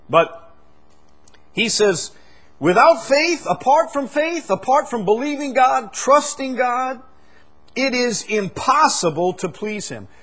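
high, loud impassioned quote of scripture; preaching at full force. Acted. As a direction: shout the verse to the rafters. Preaching the verse again.

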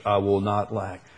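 normal talking voice

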